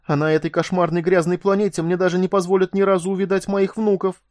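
high, bitter lament; tearful outburst. A narrator's reading. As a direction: pour out grief through tears. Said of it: "залитое слезами лицо".

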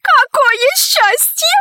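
high, drawn-out cry of bliss; childlike rapture, pitched very high; delighted exclamation. A narrator's reading. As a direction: exclaim slowly in high-voiced joy. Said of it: "воскликнула наследница".

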